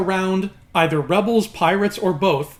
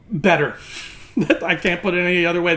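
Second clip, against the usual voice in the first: ordinary delivery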